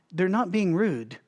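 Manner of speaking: ordinary delivery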